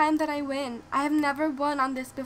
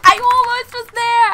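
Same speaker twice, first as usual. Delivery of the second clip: higher, much louder near-yell; loud exasperation; 8 semitones higher, 14 dB louder than usual. Real, not acted. Gamer yelling about an almost win, loud and high. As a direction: cry out in disbelief.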